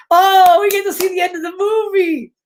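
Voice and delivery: high, loud delighted exclamation; thrilled to see it; excited. Real, not acted. Reader thrilled the film-within shows its ending, excited.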